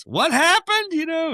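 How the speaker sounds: high, loud mock cry of surprise; loudly imitated laborer's question; bonehead laborer. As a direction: blurt it high like a startled bonehead. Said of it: "bonehead laborer".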